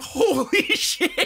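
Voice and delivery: high, drawn-out laughing exclamation; retold shock cracking into laughter; stunned. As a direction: stretch it out, high and incredulous, through laughter. Retells own stunned reaction, laughing.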